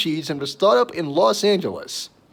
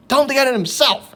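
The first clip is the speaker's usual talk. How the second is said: ferret voice; 9 semitones higher than usual. Retold or imitated.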